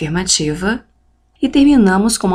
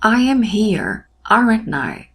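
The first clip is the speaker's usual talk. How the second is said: slow model English; slower than usual. Shown as a demonstration. Teacher modelling English example.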